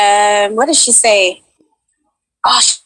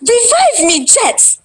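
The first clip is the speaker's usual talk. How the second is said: higher, urgent plea to be revived; performed game plea; 11 semitones higher, much slower than usual. Shown as a demonstration. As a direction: call up high for a teammate to revive you. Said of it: "three times in a row, however you like".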